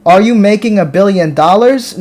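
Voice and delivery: normal talking voice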